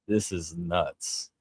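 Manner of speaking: normal talking voice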